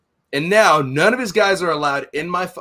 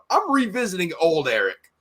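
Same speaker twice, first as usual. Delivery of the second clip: normal talking voice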